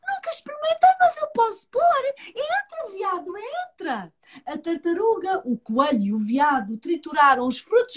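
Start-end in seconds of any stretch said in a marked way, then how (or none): 0.1-4.1 turtle voice. Acted. Turtle voice, high.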